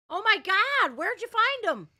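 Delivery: high and loud delighted gush. Real, not acted. A dad greeting his little son, delighted and high.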